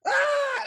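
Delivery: very high-pitched mock shriek; thin, high squeal, not full volume; imitated child scream. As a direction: mimic a toddler's tantrum scream. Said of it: "Starts screaming".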